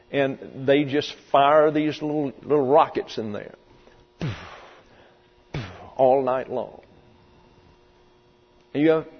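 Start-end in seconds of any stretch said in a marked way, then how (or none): none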